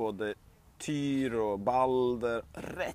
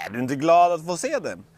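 usual speaking voice

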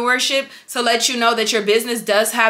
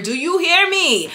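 emphatic challenge; 6 semitones higher than usual. Real, not acted. Pressing viewers for attention.

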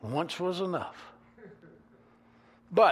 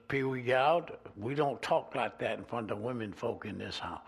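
low, quiet, stern warning; stern papa; 5 semitones lower, 4 dB quieter than usual. Retold or imitated. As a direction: lay down the house rule, low and firm. Papa rebukes Pee Wee.

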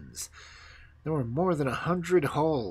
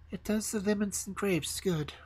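higher regal queen voice; a hint of a haughty queen; snow queen voice; 9 semitones higher than usual. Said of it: "said the Snow Queen".

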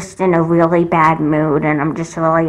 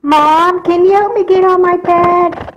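put-on a much higher, childlike plea to mom; high childish call; 12 semitones higher, 4 dB louder than usual. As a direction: call for mom like a little kid. Child calling mom from bed.

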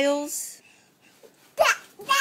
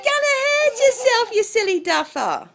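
playful teasing; 7 semitones higher than usual. Real, not acted. Teasing the bouncing baby.

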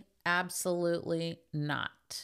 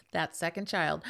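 ordinary delivery

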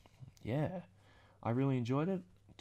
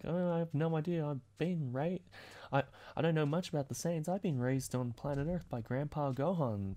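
higher, lighter innocent hero voice; Goku voice; 6 semitones higher than usual. Retold or imitated. Goku answers Vegeta, higher and naive. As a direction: play Goku as the cheerful, clueless hero. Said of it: "Goku's like".

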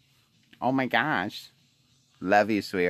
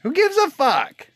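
exasperated outburst; 8 semitones higher than usual. Real, not acted. Ranting about the neighbors.

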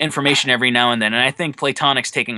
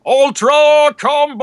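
huge, slow, high announcer shout; fighting game announcer; 12 semitones higher, 7 dB louder, slower than usual. Shown as a demonstration. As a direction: bellow it like a Killer Instinct announcer. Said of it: "Can you do that again?".

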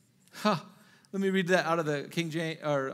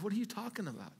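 gentle voice of God; 8 dB quieter than usual. Retold or imitated. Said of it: "He's like".

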